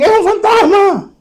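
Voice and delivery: high, loud shriek of fear; frightened disciples shrieking at a ghost on the water; terrified scream. Acted. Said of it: "de miedo se pusieron a gritar".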